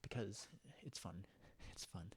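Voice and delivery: soft playful whisper; whispered "because it's fun". Real, not acted. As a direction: breathe it out teasingly.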